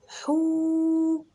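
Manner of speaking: high, حو held very long; stretched حو. Shown as a demonstration. Teacher stretches حو so children hear the long vowel. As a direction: hold the vowel of حوت long. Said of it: "شوف طولت".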